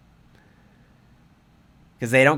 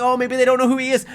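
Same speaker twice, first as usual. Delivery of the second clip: high, fast mocking whine; mock the excuse-makers; mocking naive voice; 10 semitones higher, 4 dB louder, faster than usual. Mocking viewers who excuse the players, high and fast. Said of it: "some people are like".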